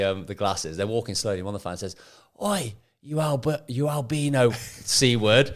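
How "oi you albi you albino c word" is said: high, loud jeering yell; retold football fan shouting, half laughing; mock fan heckle. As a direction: bellow the insult from the terraces. Fan heckles physio from stands.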